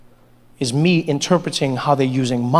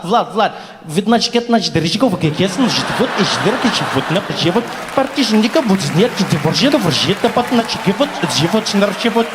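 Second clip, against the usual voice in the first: fast mock Russian gibberish; Russian accent. Man imitating Russian speech like a DJ scratching, mock Russian accent. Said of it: "like a D J ... scratching English".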